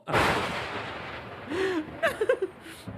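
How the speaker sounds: high, breathless real laughter; long wheezing laugh. Real, not acted. Commentator cracking up at own joke, long wheezy laugh. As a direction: crack up until only wheeze is left.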